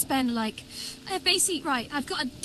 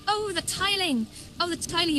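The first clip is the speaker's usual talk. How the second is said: higher, stretched, dawning on you; sudden realisation; 7 semitones higher than usual. Real, not acted. Streamer spotting the setting he missed, sudden realisation. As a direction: draw it out as the penny drops.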